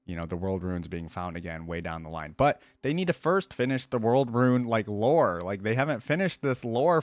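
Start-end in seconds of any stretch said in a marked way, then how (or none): none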